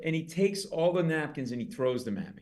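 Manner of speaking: normal talking voice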